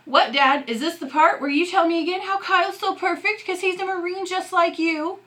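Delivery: high, loud, bitter challenge; angry outburst. Acted. Son yelling at his dad in the driveway, angry and high. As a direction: fling it at him, voice climbing high.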